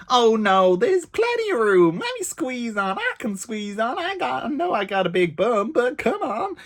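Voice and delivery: high-pitched entitled American lady; hammed-up American accent, insisting breezily; mock American accent. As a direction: imitate a pushy American tourist up high. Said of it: "I don't know what this accent is".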